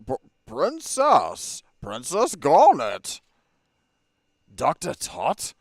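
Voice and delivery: loud, slow shocked cry; surprised. Acted. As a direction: call out in disbelief. Shocked recognition of princess.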